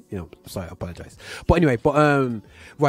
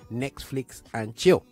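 slow quoted answer; slower than usual. Retold or imitated. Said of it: "the artist said".

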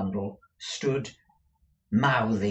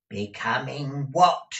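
raised, sharp rising question; 5 semitones higher, 6 dB louder than usual. A narrator's reading. Deaf Peg querying her master, sharp. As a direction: demand it sharply. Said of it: "said Peg sharply".

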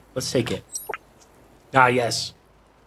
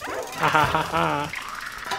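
slow mock laugh; 3 semitones higher, 5 dB quieter, much slower than usual. Taunting enemies who can't eat her.

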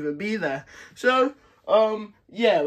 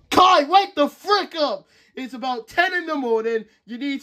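yelling to wake; 8 semitones higher, 5 dB louder than usual. Acted. Lloyd yelling at sleeping Kai to wake up. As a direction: bellow him out of bed.

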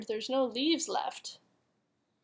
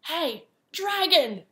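loud, slow, higher yell into an ear; shouted as loud as she could; 5 semitones higher, 5 dB louder, slower than usual. Acted. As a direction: yell right into the dragon's ear. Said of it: "She shouted as loud as she could".

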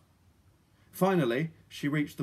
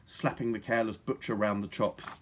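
usual speaking voice

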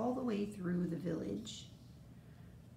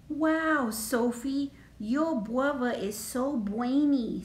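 put-on loud, high little-kid wonder; eager tagalong kid voice; admiring tagalong character; 4 semitones higher, 6 dB louder than usual. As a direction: gush like an awestruck small child. Little Tagalong Allie admires in story.

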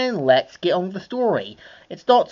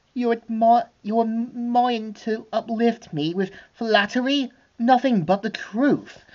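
preen like a vain villainess admiring her face; Cruella voice; 7 semitones higher than usual. Acted. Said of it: "we see her saying".

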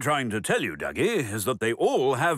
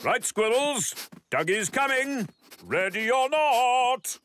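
calling out; 11 semitones higher than usual. Acted. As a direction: announce the seeker is coming. Calling out start of hide and seek.

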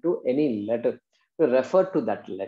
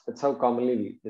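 ordinary talk

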